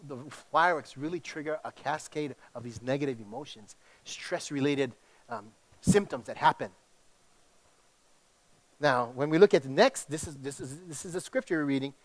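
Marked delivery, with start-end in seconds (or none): none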